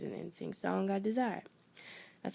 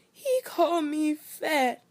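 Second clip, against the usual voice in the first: higher, louder slow tearful whine; crying complaint; 9 semitones higher, 5 dB louder, slower than usual. Retold or imitated. A child coming home crying to her parents, sobbing. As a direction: wail it like a hurt kid.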